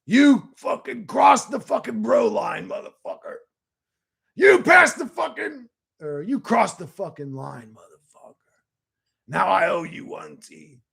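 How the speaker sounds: hammed-up yell at a friend, swear words dropped to a hiss; play-angry shout over the bro line; telling off T for crossing the bro line, a mock furious yell; mock outrage.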